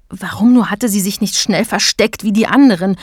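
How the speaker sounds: ordinary delivery, nothing marked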